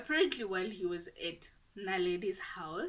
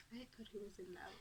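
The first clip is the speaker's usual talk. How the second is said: whisper; 15 dB quieter, faster than usual. Real, not acted. Aside whispered about boyfriend.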